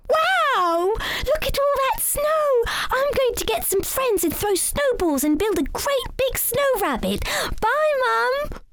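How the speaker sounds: put-on high, bright child voice full of wonder; excited little rabbit. A narrator's reading. Young rabbit leaving mother to play, high excited shout. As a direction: burst out with delight at the snow.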